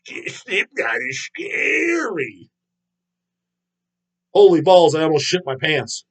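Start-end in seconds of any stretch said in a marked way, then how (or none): 0.1-2.5 high, slow, crying mock whine; whiny mock voice. Mocking a fan who finds the band scary, slow crying whine. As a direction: whimper it like a scared kid. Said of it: "You saying".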